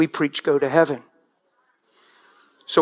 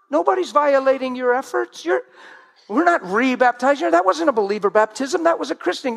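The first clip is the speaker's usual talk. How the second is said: mock cheerful reply to mother; 6 semitones higher than usual. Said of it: "And I'm like".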